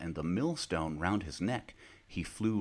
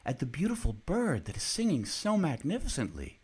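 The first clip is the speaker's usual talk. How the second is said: higher, bright cheerful father; joyful father; cheerful man; 12 semitones higher than usual. A narrator's reading. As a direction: sound delighted by the bird. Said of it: "said the man".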